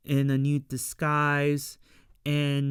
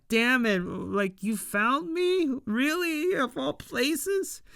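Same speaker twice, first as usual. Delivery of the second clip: much higher, whiny put-on voice of a caught villain; hammed-up dismay at being found; exasperated old man, high and whiny, slowing at the end; 9 semitones higher than usual. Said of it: "the old man's like".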